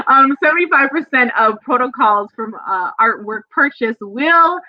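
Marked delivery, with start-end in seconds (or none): none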